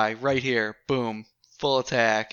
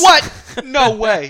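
shocked shout; 10 semitones higher, 13 dB louder, much slower than usual. Real, not acted. Shocked at missed target.

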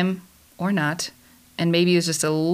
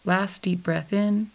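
guided meditation. Acted. Host leading listeners to recenter after a holiday gathering, calm guided meditation. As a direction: guide them through breathing and senses, unhurried and soothing.

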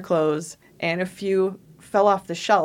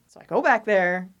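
normal talking voice

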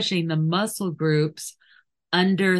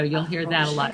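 normal talking voice

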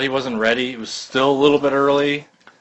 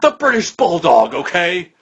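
higher, louder, exasperated boss impression; Vince; 5 semitones higher, 4 dB louder, slower than usual. Boss finally answering the commentator, mock exasperation. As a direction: blurt the answer like a fed-up boss. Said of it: "then Vince goes".

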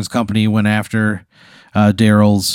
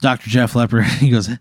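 ordinary delivery

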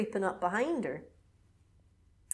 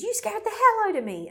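much higher, louder frightened outcry; startled outburst; 13 semitones higher, 6 dB louder than usual. Retold or imitated. Jess yelling at Peter after the fright, shrill. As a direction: shout it, still shaken. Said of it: "she goes".

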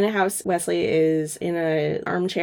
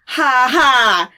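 slow, loud, forced ha-ha; hammed-up false chuckle; fake laugh; 5 semitones higher, 8 dB louder than usual. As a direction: laugh mockingly on two spoken beats. Acted dismissive fake laugh.

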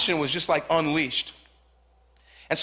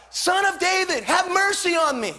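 high pleading cry, far higher; 10 semitones higher than usual. Acted. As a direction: cry out for mercy to Jesus. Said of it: "he begins to cry out".

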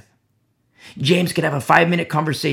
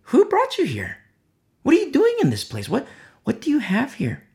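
much higher, quick demanding questions; startled questioning; 10 semitones higher than usual. Retold or imitated. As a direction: fire the questions in surprise. Scouts question the priest.

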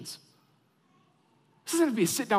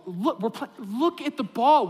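slower, louder, pleading repeat; exasperated plea; 4 semitones higher, 5 dB louder than usual. Retold or imitated. Assistant coach to daydreaming four-year-olds, exasperated plea. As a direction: insist like a desperate coach. Coach begging girls to watch.

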